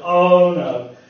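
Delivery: loud, drawn-out "oh no"; dismayed cry. Acted. Man realising he has given in to temptation, loud dismayed cry. Acting out sudden failure under temptation.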